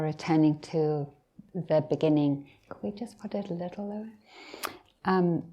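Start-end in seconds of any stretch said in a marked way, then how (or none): 2.7-4.1 soft, quick off-hand request; quiet aside. Real, not acted. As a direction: drop the voice and ask quickly. Aside asking to lower volume.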